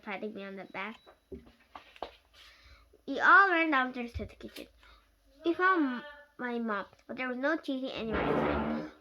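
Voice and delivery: ordinary delivery, nothing marked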